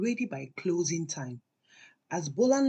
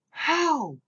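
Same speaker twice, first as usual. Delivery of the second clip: shocked; 9 semitones higher than usual. A narrator's reading. Runke shocked, one word.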